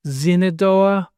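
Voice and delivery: ZINADOA slow, opening on a Z; original Iroquois word. Shown as a demonstration. Explaining the Iroquois word, slowly pronounced Zinadoa. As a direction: say Shenandoah slowly as zin-a-DO-a. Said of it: "it's an Iroquois word".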